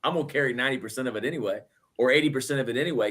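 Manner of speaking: voicing the Lord carrying the load; quick aside. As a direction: rattle it off quickly. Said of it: "he's just like".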